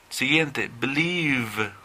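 normal talking voice

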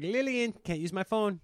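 lightly voiced dad calling to his wife; a touch of the fed-up father; Eddie Murphy's dad. Dad telling his wife Lillian she can't use the phone, lightly put on. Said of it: "he's like".

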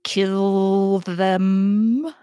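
harsh, buzzing insect voice. Acted. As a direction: rasp it out like a wasp swarm queen. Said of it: "in this harsh, buzzing voice".

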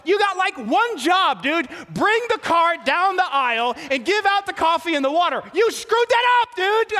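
chewing out the cart guy in your head; mock-furious inner tirade; inner rant. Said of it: "I thought to myself".

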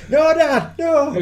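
high loud pleading cry; mock pleading. The son begging his dad, high mock plea. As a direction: squeal it like a scared kid begging.

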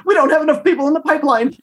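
high, fast panicky mimic; imitating panic. Guest voicing Steph fretting over the pipeline, high fast mock panic. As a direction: rattle it off high and quick, mock-worried. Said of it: "Steph said".

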